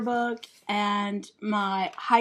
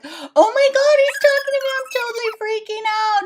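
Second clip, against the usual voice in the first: much higher and louder, giddy squeal; squealing freak-out; 12 semitones higher, 7 dB louder, faster than usual. Acted. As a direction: squeal in panic over the boy talking to you. Inner panic, valley girl.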